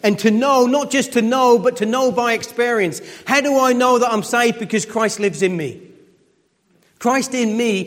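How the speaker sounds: impassioned pulpit preaching; preaching at full force. Acted. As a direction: build the point like a preacher in full flow.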